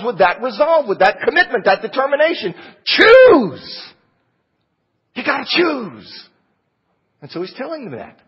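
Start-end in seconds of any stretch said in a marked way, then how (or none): 2.8-6.3 impassioned command. Real, not acted. Preacher commands congregation to decide.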